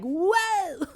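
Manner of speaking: high startled whoa; surprised whoa. Retold or imitated. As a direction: jolt up in surprise. Hades reacts to strength.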